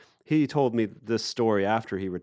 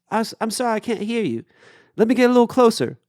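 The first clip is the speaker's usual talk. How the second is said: high mock call, growing louder; imitated distant reply; 12 semitones higher, 6 dB louder, faster than usual. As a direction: shout back from a drifting boat. Boatman calling back.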